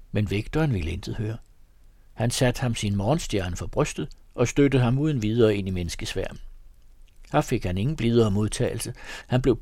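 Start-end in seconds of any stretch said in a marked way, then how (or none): none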